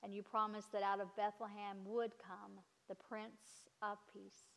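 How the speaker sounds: ordinary talk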